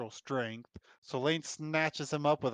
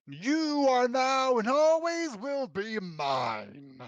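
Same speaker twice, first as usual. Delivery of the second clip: possessive werewolf; 11 semitones higher, 6 dB louder, much slower than usual. Acted. Werewolf claims her lover.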